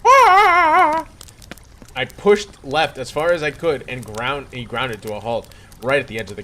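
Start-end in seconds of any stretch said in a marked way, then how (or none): 0.1-1.1 high loud scream of fear; Mario's scream. Acted. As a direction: shriek high and loud like a video game hero. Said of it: "He just screamed".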